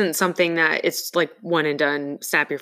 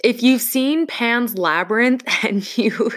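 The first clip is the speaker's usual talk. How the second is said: higher, laughing through the words; 6 semitones higher than usual. Real, not acted. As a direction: giggle while you explain it. Laughing at Pan's Labyrinth memory.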